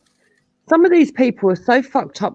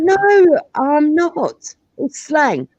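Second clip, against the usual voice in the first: higher, louder, insistent denial; heated denial; 10 semitones higher, 5 dB louder, much slower than usual. Retold or imitated. She denies it to Maku, higher and louder. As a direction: fire back, defending yourself.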